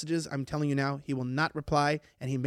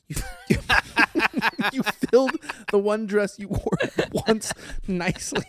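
laughing; 2 semitones higher than usual. Real, not acted. Laughing while reading.

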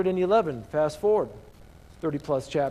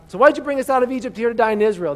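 complaining; 6 semitones higher, 7 dB louder, faster than usual. Acted. Israelites complaining to Moses.

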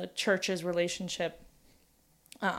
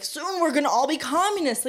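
mock alarm; 6 semitones higher, 3 dB louder than usual. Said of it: "people would be like".

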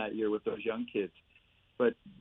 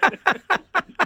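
loud, high real laugh bursting out; high burst of laughter; 9 dB louder than usual. Real, not acted. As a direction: let the laugh explode up high. Laughing at own story.

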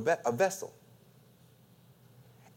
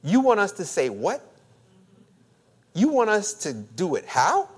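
"what" and "how" swept up at the end; incredulous inflection; 2 semitones higher, 3 dB louder than usual. Acted. Preacher showing what we say to God, incredulous. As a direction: push the last word up in disbelief. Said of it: "put that inflection on the back".

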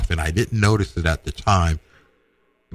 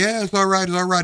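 nervous reassurance; 13 semitones higher than usual. Retold or imitated. Boy nervously reassures his dad.

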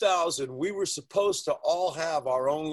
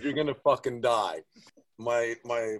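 ordinary talk, nothing marked

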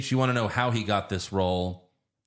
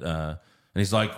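normal talking voice

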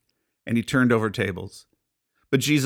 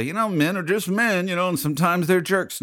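higher, dismissive mock of the culture's excuse; 6 semitones higher than usual. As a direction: shrug it off like a careless bro.

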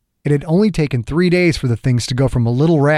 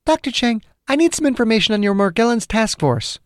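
much higher voice for the heroine; woman character's voice; 10 semitones higher than usual. Acted. Margaret asks Chang about his task force, high woman's voice. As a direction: pitch it high, as a female caller. Acted heroine makes her request.

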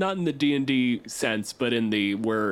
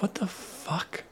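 startled whisper; 8 semitones higher, 6 dB quieter, slower than usual. Acted. Guards startled by tiny fairy.

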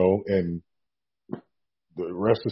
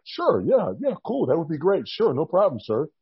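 higher, eager-to-please agreement; acted polite composure; 6 semitones higher than usual. Rookie accepting the boss's offer, polite high-pitched yeses. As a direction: agree politely, holding the excitement in. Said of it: "sitting there like".

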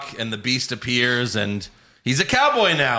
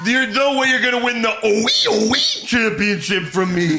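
high, laughing slurred mock yell; mock Lashley slur; 8 semitones higher, 3 dB louder than usual. Co-host redoes Lashley's line, high slurred laughing mock yell. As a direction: mangle the words like a lisping wrestler. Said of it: "He's yelling at Seth Rollins".

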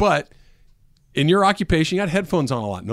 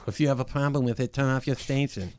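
softer, a lightly voiced Tyson; Mike Tyson impression; 6 dB quieter than usual. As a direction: quote the boxer quietly in his voice. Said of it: "That's actually a pretty good impression there".

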